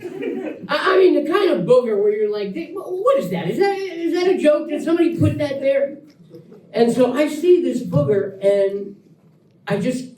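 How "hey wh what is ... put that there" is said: incredulous. Retold or imitated. Mock reaction to the booger.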